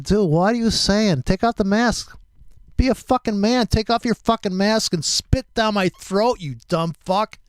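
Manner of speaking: retold angry tirade, pitch jumped up; triggered. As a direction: tell him off in exasperation, high voice. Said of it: "But see, I'm easily triggered".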